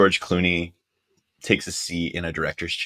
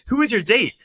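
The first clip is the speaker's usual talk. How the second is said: much higher, quick put-on voice; imitating George Clooney. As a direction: rush the question up high. Repeating question, voiced Clooney.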